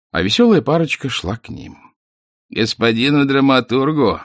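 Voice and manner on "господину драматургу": joking. A narrator's reading. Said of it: "шутливо кланялись они".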